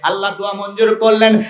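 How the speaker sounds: ordinary delivery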